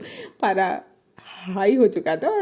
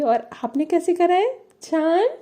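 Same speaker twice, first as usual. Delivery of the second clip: high, mocking sweet "जान" with a bite; sarcastic jaan; 8 semitones higher than usual. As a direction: throw "जान" back with icy sarcasm. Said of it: "ये जो tone में जान बोला था ना". (ताने भरे लहजे में "जान")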